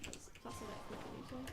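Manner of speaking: puzzled murmur under the breath; quiet mutter. Real, not acted. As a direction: mumble it to yourself, barely audible. Muttered then repeated loudly.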